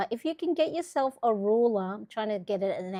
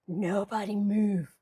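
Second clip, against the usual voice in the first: slow command. Acted. Livestreamer freezing camera setup.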